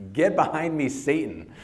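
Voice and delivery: a sharp, loud reprimand; forceful rebuke. Acted. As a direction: bark it out like Jesus rebuking.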